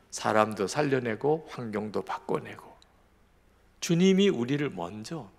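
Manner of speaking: normal talking voice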